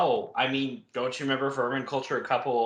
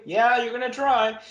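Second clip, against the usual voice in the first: high, louder taunting comeback; mocking retort; 9 semitones higher, 6 dB louder than usual. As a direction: throw it back with a sneer. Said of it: "Tala and Tugger are like".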